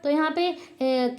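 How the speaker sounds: normal talking voice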